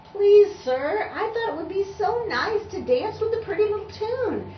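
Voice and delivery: high, loud pleading; earnest plea. Acted. Tubby pleading with the conductor, earnest and high.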